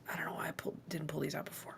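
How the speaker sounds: unvoiced whisper to a friend. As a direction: lean in and whisper it. Character whispers aside to Brick.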